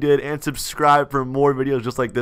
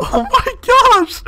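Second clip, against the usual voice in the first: laughing shriek; 5 dB louder, slower than usual. Real, not acted.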